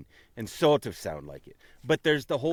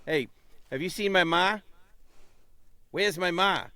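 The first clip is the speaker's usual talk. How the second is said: Boston ma, higher and open; Boston accent demo, raised pitch; 9 semitones higher than usual. Shown as a demonstration. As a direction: call for your ma like a Boston local. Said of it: "if you're saying".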